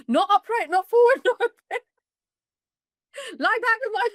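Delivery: laboring mother mocking the pushing advice; laughing protest. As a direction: refuse each position with rising glee. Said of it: "So I was like".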